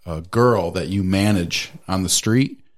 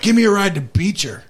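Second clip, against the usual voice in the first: mock pushy stranger; 9 semitones higher than usual. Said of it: "she's like".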